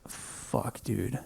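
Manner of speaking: whispered realization. Retold or imitated. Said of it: "And then I realized".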